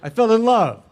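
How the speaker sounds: high, loud, falling at the end; emphatic declaration. Real, not acted. Actor repeating to laughing audience, high emphatic declaration. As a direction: insist on it with comic fervour.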